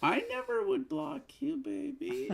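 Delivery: very high, sly little lizard voice; chameleon. Acted. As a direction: squeak it up high like a scheming tiny lizard. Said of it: "When you talk in that little lizard voice".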